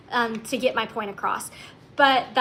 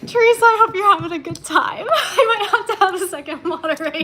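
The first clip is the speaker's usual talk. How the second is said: high, laughing through words; 6 semitones higher, 6 dB louder than usual. Real, not acted. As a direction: giggle while talking. Laughing at comments.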